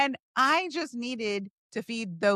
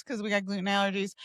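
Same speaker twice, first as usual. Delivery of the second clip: ordinary talk, nothing marked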